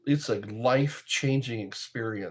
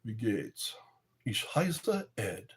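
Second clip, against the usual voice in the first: soft German retry; 3 semitones lower, 8 dB quieter than usual. Shown as a demonstration. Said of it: "Is that how they say welcome?".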